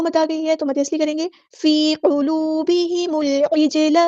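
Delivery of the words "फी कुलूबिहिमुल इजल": फी कुलूबिहिमुल इजल chanted slowly, long vowels held; slow recitation. Shown as a demonstration. Teacher reciting verse to class, slow. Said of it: "या मद आ गई है".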